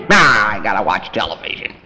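low, bored refusal; sullen kid. Retold or imitated. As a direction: brush it off like a lazy teen.

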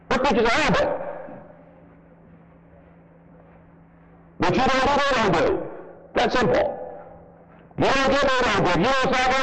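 high, ringing pulpit preaching; fiery revival preaching; full-force preaching. Acted. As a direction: hammer the point from the pulpit.

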